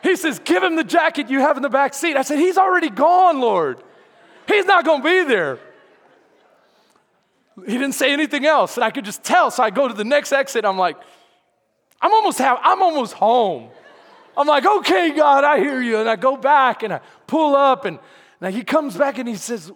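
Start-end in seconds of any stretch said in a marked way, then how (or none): 0.4-2.2 high, loud repeated order; insistent raised-voice command; emphatic command. Retold or imitated. Instruction repeated loudly after audience aside. 2.4-5.6 high, loud comic objection; mock-exasperated shout; loud protest. Arguing with God in story. 12.0-13.7 loud, high comic complaint; mock-exasperated holler; exasperated protest. Complaining to God at exit. 14.7-16.0 high, loud surrender; mock-exasperated concession; exasperated giving in.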